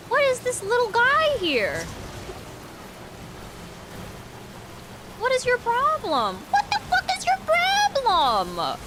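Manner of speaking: shrill-pitched, drawn-out scold at the critter; high squeaky scolding. Real, not acted. Gamer scolding a little creature in a high, slow squeak. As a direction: talk way up high at the little guy.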